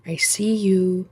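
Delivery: slow, low, distant menace; ominous slow voice. Pretend. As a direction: intone it like an all-seeing eye. Eye of Sauron joke.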